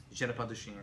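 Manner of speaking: low, easy French accent; smooth, understated French showing off; suave French. Shown as a demonstration. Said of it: "you sound suave".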